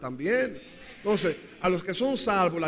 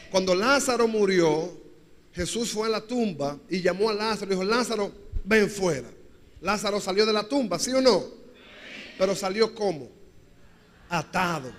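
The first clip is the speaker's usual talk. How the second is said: louder preacher's story, building to a call; fervent preaching; 3 dB louder than usual. Acted. Pastor to congregation, louder fervent preaching. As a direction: rouse the church with Lázaro.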